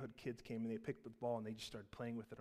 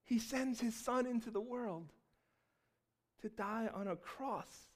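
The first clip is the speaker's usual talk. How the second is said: fervent pulpit delivery; preaching at full force; 9 semitones higher, 9 dB louder than usual. Acted. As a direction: preach it out to the whole church. Preaching Christmas message.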